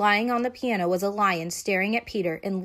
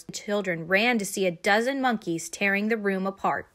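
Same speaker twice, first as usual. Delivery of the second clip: ordinary delivery, nothing marked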